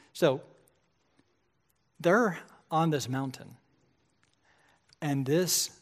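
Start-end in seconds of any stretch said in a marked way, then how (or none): none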